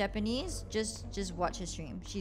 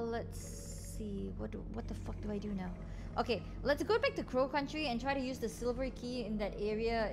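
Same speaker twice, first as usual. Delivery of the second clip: normal talking voice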